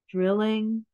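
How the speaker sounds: plain reading voice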